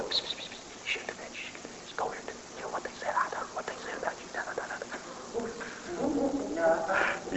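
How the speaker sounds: fast breathy whispering gossip; the whisperer. Acted. Preacher voicing the inner whisperer, fast gossiping whisper. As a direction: hiss like a voice in your ear. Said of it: "It's also called the whisperer".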